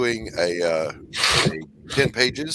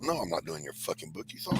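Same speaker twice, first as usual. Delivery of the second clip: lower, soft blunt refusal; deadpan refusal; 4 semitones lower, 11 dB quieter than usual. Pretend. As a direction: drop the act flatly. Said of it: "drops the act, refuses".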